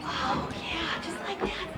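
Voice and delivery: hushed whispered praise. Real, not acted. Teacher praising wiggling child, whispered. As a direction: breathe the praise softly.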